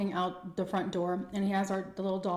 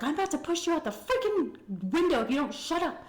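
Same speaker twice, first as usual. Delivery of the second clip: friend's threat; 8 semitones higher than usual. Retold or imitated. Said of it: "my friend was like".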